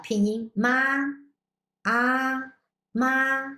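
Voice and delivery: ma held level and high; ah and ma held on one high flat pitch; first tone. Shown as a demonstration. Teacher demonstrating first tone on ma. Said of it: "First tone".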